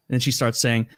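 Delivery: normal talking voice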